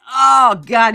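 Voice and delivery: loud, pained "Oh, God"; loud exasperated cry. Real, not acted. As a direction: blurt it out in disgust, full volume. Reacting to cringe video.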